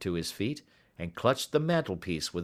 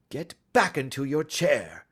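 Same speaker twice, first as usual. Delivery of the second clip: stern; 6 semitones higher, 4 dB louder than usual. A narrator's reading. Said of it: "said Holmes sternly".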